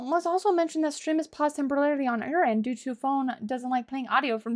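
ordinary talk

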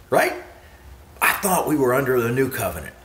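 ordinary talk

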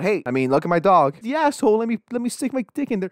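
half-hearted impression of a sleazy guy thinking aloud; mocking the dog owner's twisted thought; mock creep voice. Imagined thought of the abuser.

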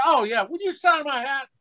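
very high-pitched eager request; imitated autograph seeker. Bus passenger asks actor for autograph, eager high voice. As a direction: ask in a squeaky fan voice. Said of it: "And he goes".

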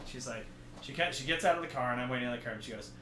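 ordinary delivery

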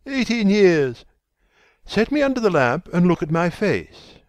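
high, loud, exultant challenge; triumphant. A narrator's reading. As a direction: ring out the victory, then command. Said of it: "with a touch of triumph in his voice".

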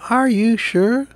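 a hint of a doubtful younger brother; little pilgrim. A narrator's reading. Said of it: "the little pilgrim said".

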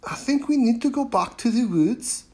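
put-on high, determined girl; a girl proposing a plan; Ebba's voice. A narrator's reading. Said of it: "Ebba said".

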